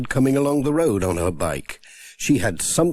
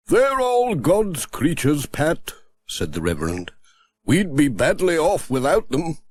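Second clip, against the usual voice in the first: a lightly voiced clergyman; gentle vicar; 5 semitones higher than usual. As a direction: say it like a patient country vicar. The Reverend voiced in story.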